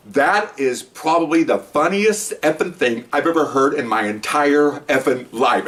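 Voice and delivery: drawn-out, deliberate sergeant's verdict; slow, weighty pronouncement. Retold or imitated. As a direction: spell it out slowly, word by word.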